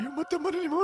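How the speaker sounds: high, strained mock confession; mock deathbed confession. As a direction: squeeze it out high like a dying man. Acting out last words.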